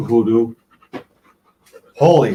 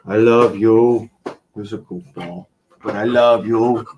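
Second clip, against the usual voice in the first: tender to the dog; 5 semitones lower than usual. Real, not acted.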